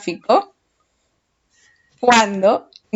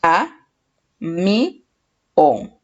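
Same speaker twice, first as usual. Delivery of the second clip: "A, mi, on" in separate beats, "on" lower; syllable by syllable; 3 semitones lower, much slower than usual. Shown as a demonstration. Teacher asking a pupil to help split camión, syllable by syllable. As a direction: pause after each piece of "A, mi, on". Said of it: "Separamos en sílabas".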